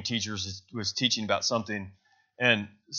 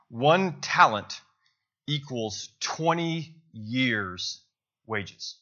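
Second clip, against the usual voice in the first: slow emphasis; 3 semitones higher, much slower than usual. Real, not acted. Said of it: "slow emphatic explanation".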